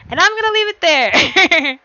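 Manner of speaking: spring the cliffhanger gleefully; excited teasing. Real, not acted. Teasing cliffhanger announcement, laughing.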